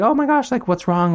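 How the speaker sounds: high-pitched startled concern, lightly acted; imitated motherly concern. Mom seeing her child start crying, imitated alarmed concern.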